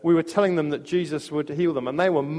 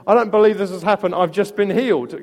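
put-on retelling the healed teen's joyful outburst, louder and quick; excited shout; 4 semitones higher, 6 dB louder than usual. Preacher retells the healed boy's cry to the crowd, excited and loud. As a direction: blurt it out in astonished joy, fast.

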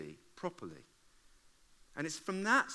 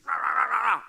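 mock row noise; 8 semitones higher, 12 dB louder than usual. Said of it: "those rows where you're in the car".

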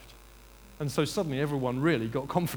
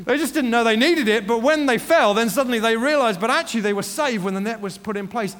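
loud, high, impassioned preacher; full-force preaching; 8 semitones higher, 12 dB louder than usual. Real, not acted. Preacher assuring the congregation they are never left, impassioned. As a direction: drive each phrase home with raised voice.

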